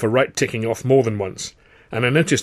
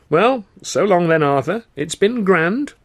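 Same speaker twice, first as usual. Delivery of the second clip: smug, slow goodbye, a little higher; smirking farewell; 8 semitones higher, 4 dB louder, slower than usual. A narrator's reading. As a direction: let the smirk be heard in a lazy so long. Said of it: "he smirked".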